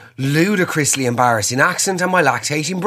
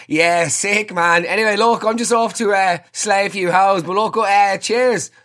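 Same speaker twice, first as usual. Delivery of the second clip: high, eager blokey banter; overdoing the one-of-the-lads act; hammed-up lad voice; 7 semitones higher than usual. Chatting up the installer with macho bro talk.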